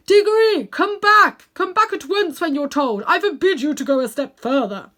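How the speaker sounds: loud, high calling out from a distance; sharp commanding yell; shouting. A narrator's reading. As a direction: shout him back from far off.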